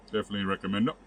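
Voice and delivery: talking with a mouth full of bread; chewing while talking. Real, not acted. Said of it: "I'm talking with my mouth full".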